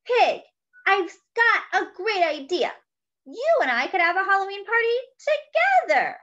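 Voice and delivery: high, quick, eager mouse voice; little mouse bouncing with excitement; excited mouse. Acted. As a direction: burst out with the great idea. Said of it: "He was very excited".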